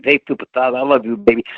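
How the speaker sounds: imitating a lisping wrestling promoter; Dusty Rhodes. Shown as a demonstration. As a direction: lisp it like a wrestler's signature voice. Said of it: "when Dusty talks with a lisp".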